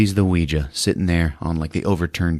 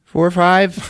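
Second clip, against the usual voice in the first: high-pitched imitating a caller; high mock phone call; 11 semitones higher than usual. Man phoning the hospital, high mock voice. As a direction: play the nervous caller, pitched up. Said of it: "He's like".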